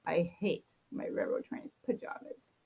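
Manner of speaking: ordinary delivery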